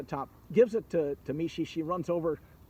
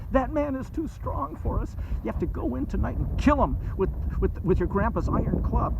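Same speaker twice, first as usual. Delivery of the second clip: higher, urgent scheming voice; plotting dragon mother; 6 semitones higher, 4 dB louder than usual. Acted. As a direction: urge your son to do the deed. Mother plots the killing.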